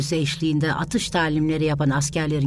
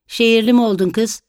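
higher, louder sharp scolding; mother scolding her daughter's city airs; mocking rebuke; 7 semitones higher, 4 dB louder, faster than usual. A narrator's reading. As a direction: tell her off with scorn. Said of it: "diye çıkıştı".

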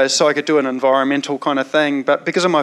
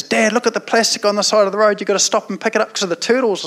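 put-on pitched-up insistent little boy; son at the lights; 6 semitones higher than usual. Son in the car pleading to stop, higher child voice. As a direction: urge Dad like a kid who won't let it go. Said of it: "And he goes".

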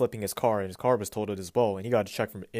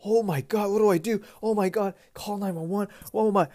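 high, mock-panicked freaking out; mocking how most people would panic; 10 semitones higher than usual. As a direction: imitate someone losing it, pitched way up. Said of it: "would have been freaking out and be like".

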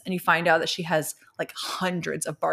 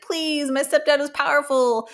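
put-on begging teen girl; 8 semitones higher, 3 dB louder than usual. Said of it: "And she's like".

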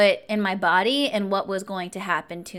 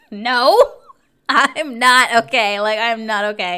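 upset outburst; 3 semitones higher, 10 dB louder, slower than usual. Retold or imitated. Shaken woman answering the guard, retold as a loud outburst. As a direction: blurt it out loud, slowing on the denial.

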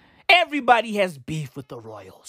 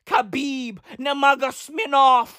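ordinary talk

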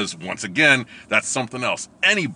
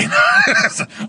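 loud laugh; 11 dB louder than usual. Real, not acted. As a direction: burst of high laughter. Laughing at own story.

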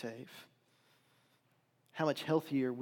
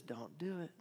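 hushed, soft warning from within; small inner voice; 12 dB quieter than usual. Acted. Preacher voices the small interior voice for the congregation, hushed. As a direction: imitate a quiet voice of conscience, much softer. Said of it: "that small voice that says".

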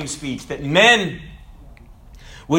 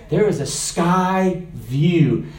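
ordinary delivery

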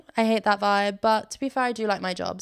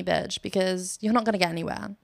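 normal talking voice

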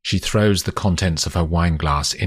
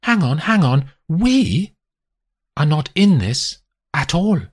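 getting angry; 10 semitones higher, 3 dB louder than usual. A narrator's reading. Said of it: "getting angry".

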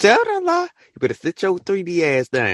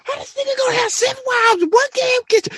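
shrieking disbelief. Retold or imitated. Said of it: "I said".

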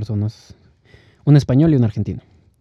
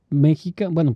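ordinary delivery

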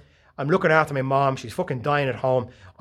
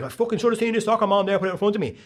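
higher-pitched, worked-up complaint; acted ranting; 6 semitones higher, faster than usual. Driver retelling the school run at work, acted rant. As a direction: rant indignantly about another driver.

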